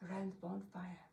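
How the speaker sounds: soft, near-whisper storytelling; hushed narration. A narrator's reading. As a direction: draw listeners in, barely voiced.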